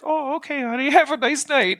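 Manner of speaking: put-on high, sweet little-old-lady voice, lilting. Imitating the ninety-three-year-old lady saying goodnight on the phone, high and sweet. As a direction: coo it like a kindly grandma. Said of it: "she was just like".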